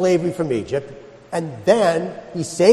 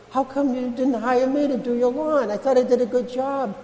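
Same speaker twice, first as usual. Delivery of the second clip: hurt gardener; 9 semitones higher than usual. Retold or imitated. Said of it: "So I ask you".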